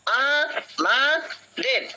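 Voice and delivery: আ, মা, দের drawn out very slowly, each beat separate; slow syllable demo for the class. Shown as a demonstration. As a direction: stretch আ মা দের into three slow pushes. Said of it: "উচ্চারণ করতে গেলে দেখো কি আসে".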